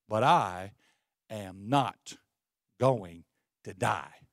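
very low, word by word; slow, weighty declaration with a pause after each phrase; deliberate defiance. Acted. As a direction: lay each word down firmly, deep and slow. Solemn defiant answer to the devil.

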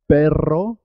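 perro with rolled rr, rising at the end; double R. Shown as a demonstration. Teacher presents perro as the important contrast, rr rolled. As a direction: trill the R in perro. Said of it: "suena doble".

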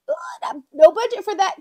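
high, loud, whiny ugh of frustration; a put-upon wail about no budget; exasperated groan. Retold or imitated. Complaining to herself about an unexpected expense, in a high, loud whine. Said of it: "I'm like".

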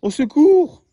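high, loud panicked cry; yelled for help. Acted. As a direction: scream for help while flying off. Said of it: "hurla Albert".

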